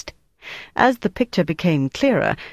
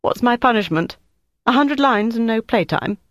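making light; 7 semitones higher than usual. Acted. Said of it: "tried to make light of it".

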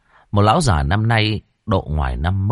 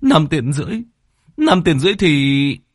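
higher, louder dismayed repetition; despairing disbelief; 7 semitones higher, 3 dB louder than usual. Acted. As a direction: repeat the sum in horror. Khoai despairs at the sum. (kêu trời tuyệt vọng)

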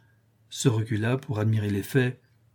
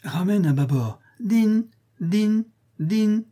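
play steamboat captain; 9 semitones higher, slower than usual. Pretend. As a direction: order called out, then high bell notes. Plays captain and ship's bell.

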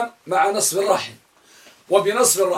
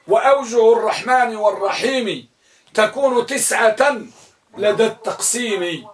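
slow, raised verse line with a laugh; verse recitation with laugh; 4 semitones higher than usual. Shown as a demonstration. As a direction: recite the rhyme, amused. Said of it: "قال القائل". (الشيخ ينشد البيت لطلابه ضاحكا)

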